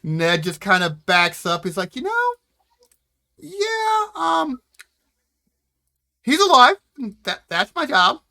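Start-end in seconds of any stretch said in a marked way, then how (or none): 2.0-8.2 raised, halting excuse-making with ums; hesitant backing off. Acted. Ned backing away from Wilbur, telling Eddie to leave, uneasy. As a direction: back away awkwardly, voice up and loud.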